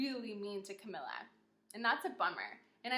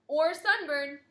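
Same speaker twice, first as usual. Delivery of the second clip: booming doctor; 4 semitones higher, 10 dB louder, slower than usual. Acted. Fourth doctor adds.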